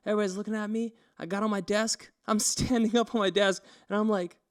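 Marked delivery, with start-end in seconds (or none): none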